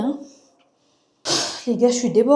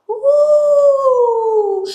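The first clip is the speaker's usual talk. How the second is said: écho; 10 dB louder than usual. Real, not acted. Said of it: "vous allez entendre l'écho".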